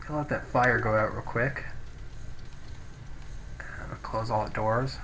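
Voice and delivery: ordinary delivery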